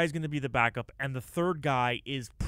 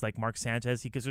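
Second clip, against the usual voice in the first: usual speaking voice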